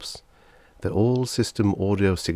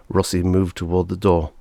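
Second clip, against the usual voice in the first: ordinary delivery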